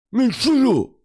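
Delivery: high mushy "shut up" through loose dentures; squeaky lisping brush-off; toothless old con. Retold or imitated. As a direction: mimic a man whose teeth won't stay in. Toothless inmate telling him off.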